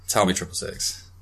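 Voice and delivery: ordinary delivery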